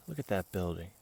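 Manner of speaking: usual speaking voice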